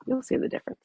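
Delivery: soft breathy whisper of the promise; whispered repeat. Real, not acted. Whispering to the group to drive the promise home.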